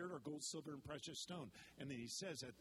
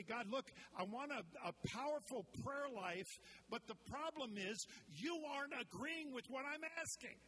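whiny demanding prayer; 8 semitones higher than usual. Retold or imitated. Mock prayer demanding from God.

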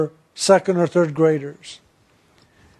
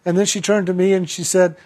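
ordinary delivery

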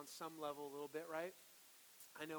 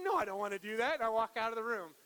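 loud refusal; 4 semitones higher, 11 dB louder, faster than usual. Retold or imitated. Pastor acting his own refusal to his wife, loud. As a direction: blurt a loud, stubborn no. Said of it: "It's like".

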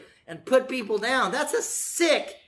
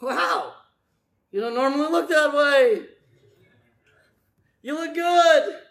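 mock surprised praise; 4 semitones higher, 5 dB louder than usual. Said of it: "what are they saying".

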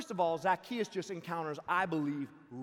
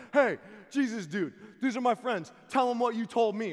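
excited friend; 7 semitones higher, 12 dB louder than usual. Retold or imitated. Friend introducing him excitedly.

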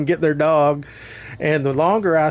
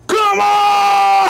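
huge high yelling battle cry; Hulk roar; 7 dB louder than usual. Retold or imitated. Roaring at the dog like the Hulk, full shout. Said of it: "do the Hulk".

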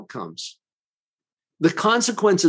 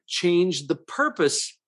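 usual speaking voice

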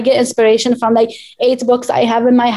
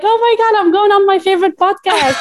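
high-pitched gushing, re-enacted; giddy, high retelling with a laugh at the end; excited announcement; 9 semitones higher than usual. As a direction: gush the big news to loved ones. Retelling excited news to loved ones.

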